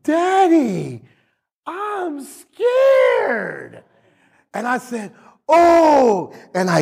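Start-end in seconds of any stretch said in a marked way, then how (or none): 0.0-3.8 put-on high frightened little boy's voice, cried out; scared child. Pastor voicing his scared son on the plane, high and frightened. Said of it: "and he said". 5.5-6.3 startled oh. Retold or imitated. Reacting to son's fear.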